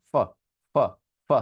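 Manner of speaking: English f in each ফ; lip-and-teeth ফ. Shown as a demonstration. Teacher repeating the new sound for class, English f as ফ. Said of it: "This is ফ sound".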